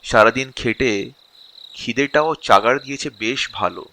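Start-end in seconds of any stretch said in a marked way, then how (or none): none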